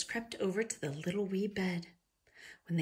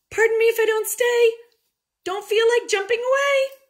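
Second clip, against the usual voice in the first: loud high panic; 13 dB louder than usual. A narrator's reading. Goldilocks fleeing in panic.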